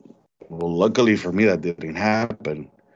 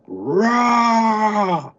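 sudden high roar, much higher; imitated simian roar; 12 semitones higher, 3 dB louder than usual. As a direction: bark out a high ape-like roar. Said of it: "like, a simian".

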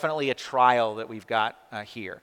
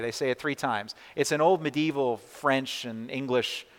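usual speaking voice

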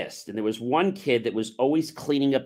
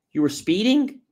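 retold surprise, higher and rising at the end; mock-incredulous check, pitched up; incredulous question; 8 semitones higher, 3 dB louder than usual. Asking a friend about his ticket, incredulous.

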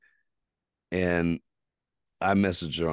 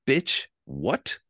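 higher, incredulous snap; outraged disbelief; 13 semitones higher, 5 dB louder than usual. Retold or imitated. Reacting to being blocked, outraged. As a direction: spit it out in disbelief.

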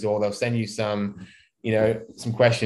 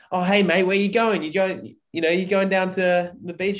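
pushy charity mugger; 6 semitones higher than usual. Retold or imitated. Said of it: "Someone comes up to you on the street".

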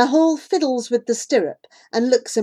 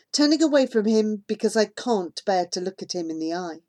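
usual speaking voice

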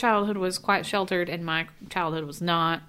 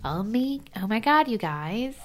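higher, drawn-out Valley talk with rising end; Valley accent; 4 semitones higher, slower than usual. Retold or imitated. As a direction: imitate a Valley accent, stretching the words slowly. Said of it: "I sounded like I was from the Valley".

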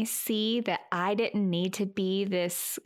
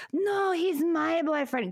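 higher, slow, drawn-out protest; jealous boyfriend; 7 semitones higher, slower than usual. Retold or imitated. Woman retelling the rival guy cutting in, mock jealous. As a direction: stake a claim, pitched up and dragged out.

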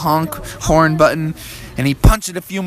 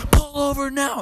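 high, thin commanding call; police officer; 12 semitones higher, 4 dB quieter than usual. Acted. Police order the family over, high command. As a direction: order it in a raised cop voice. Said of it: "the police said".

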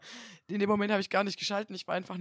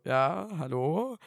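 slow, drawn-out puzzled hello; 2 semitones lower, much slower than usual. Retold or imitated. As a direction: ask it slowly, confused. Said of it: "Und ich so".

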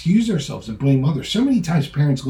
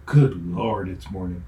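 groaning dread; 4 semitones lower, slower than usual. Shown as a demonstration. Preacher's grumpy start to the day, a weary groan. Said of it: "Same words, different meaning".

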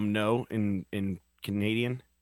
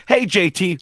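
loud, high hailing greeting; big hammed-up on-air hello; mock talk-show host; 7 semitones higher, 9 dB louder than usual. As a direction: imitate a host calling out initials. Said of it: "you'd be like".

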